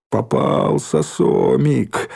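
drawn-out gloating call, narrated without real yelling; understated jeering holler; mild mock yell. A narrator's reading. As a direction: crow over a caught victim, lightly. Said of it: "заорал он".

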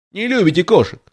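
lightly voiced high mimicking squeak; a hint of a mouse mocking her words; the mouse mimics Alice in a small piercing voice. A narrator's reading. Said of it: "передразнила мышь пронзительным голоском".

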